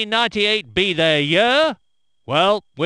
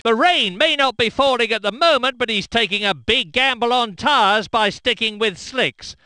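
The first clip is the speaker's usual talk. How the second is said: ordinary talk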